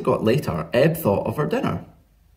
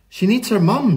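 high, louder eager voice; higher-pitched insistent Flo; young Flo; 10 semitones higher, 5 dB louder than usual. A narrator's reading. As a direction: pipe up, high and emphatic. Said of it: "said Flo".

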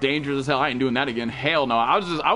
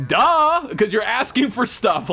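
mocking duh; 9 semitones higher, 5 dB louder, much slower than usual. Real, not acted. Mocking reply to viewers.

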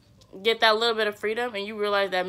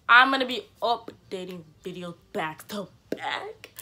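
whispering; slower than usual. Real, not acted. Aside to the camera.

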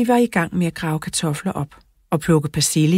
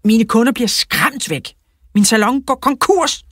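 high, loud cry of complaint; indignant shout; 8 semitones higher, 5 dB louder than usual. A narrator's reading. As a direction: cry out your grievance, pitch climbing. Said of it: "råbte frisør Freddy".

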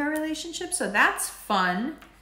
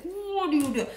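Saturn teasing; 9 semitones higher than usual. Retold or imitated. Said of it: "Saturn energy to be like".

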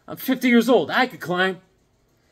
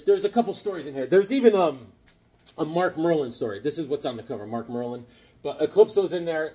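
ordinary talk

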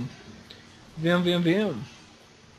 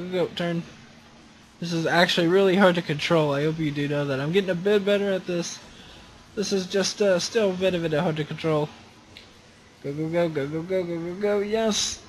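normal talking voice

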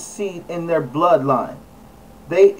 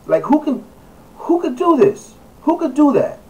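who could do this, appalled and higher; incredulous outrage; 9 semitones higher than usual. Real, not acted. Vlogger appalled at hunters posing with dead lion, incredulous. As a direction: pitch jumps way up in disbelief. Outraged at trophy hunting photo.

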